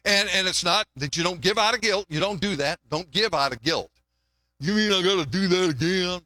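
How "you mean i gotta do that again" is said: mock grumbling giver in a put-on voice; reluctant complainer. Churchgoer giving out of guilt, mock complaining.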